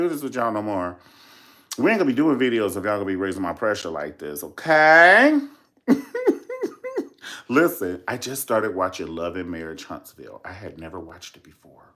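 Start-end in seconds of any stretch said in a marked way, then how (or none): 4.4-5.4 loud, rising okay to end the rant. Real, not acted. To viewers raising her pressure, a loud rising okay. 5.9-7.4 high burst of laughter. Real, not acted. After scolding viewers, a high burst of laughter.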